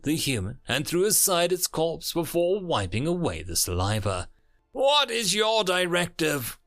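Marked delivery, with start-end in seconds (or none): 4.7-6.6 high-pitched, forceful interrogation; raised, insistent alien question; insistent demand. A narrator's reading. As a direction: demand it of a pinned captive. Alien interrogates pinned human.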